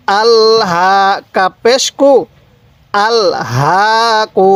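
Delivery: ordinary talk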